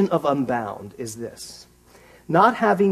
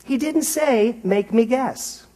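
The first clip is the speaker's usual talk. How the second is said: quoting Edwene; 8 semitones higher, 6 dB louder, slower than usual. Retold or imitated. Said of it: "She said".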